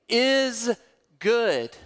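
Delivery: is held apart, then good landed firmly with a falling close; slow it down and let good fall like a verdict; solemn pause, then good punched and falling; weighty pause before good. Real, not acted. Emphatic declaration of God's goodness.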